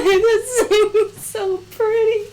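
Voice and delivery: slow and high, laughing through tears; gushing while half crying; teary laughter. Real, not acted. Fan praising a gorgeous anime shot, teary laughter. Crying over a beautiful scene.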